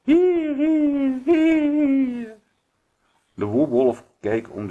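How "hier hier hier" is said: high, loud, drawn-out echo; echoing call. A narrator's reading. Stones answering the wolf from seven sides, high echoing call. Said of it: "van zeven kanten klonk het".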